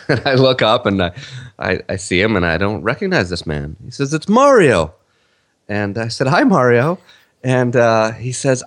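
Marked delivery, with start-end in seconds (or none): 4.1-4.9 high, louder retold greeting; eager introduction. Excited fan introducing himself to the author, eager retold introduction. 6.3-7.0 retold greeting. Retold greeting back to stranger.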